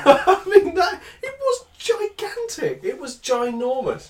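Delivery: high, slow, laughing through the words; laughing disbelief. Real, not acted. Father laughing helplessly about the size of his son's poo. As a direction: squeal it out in disbelief.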